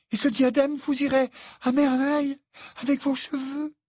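very high, loud character voice; conciliatory mother. A narrator's reading. Mother offering the fiancée a wedding diadem, conciliatory. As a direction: offer it earnestly in a high-pitched voice.

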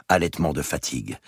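ordinary delivery

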